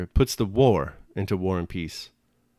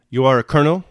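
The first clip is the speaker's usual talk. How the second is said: put-on shouted with German accent; 4 semitones higher, 9 dB louder, faster than usual. A narrator's reading. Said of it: "shouted the chief of staff with a German accent".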